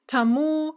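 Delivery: "тому" stressed on the last syllable, ta-MU; stress on final u. Shown as a demonstration. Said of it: "note the stress on the u".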